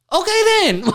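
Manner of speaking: very high, emphatic point-scoring; mock triumphant retort. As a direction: pounce on the answer as proof. Dad's triumphant retort in retold argument.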